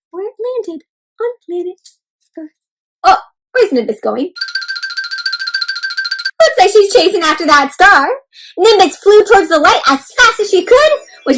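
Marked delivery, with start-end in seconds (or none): none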